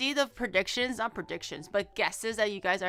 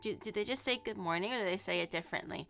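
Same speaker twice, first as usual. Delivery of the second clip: ordinary talk, nothing marked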